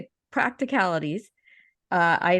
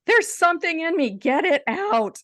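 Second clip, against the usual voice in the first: panicked yell; 10 semitones higher, 4 dB louder than usual. Retold or imitated.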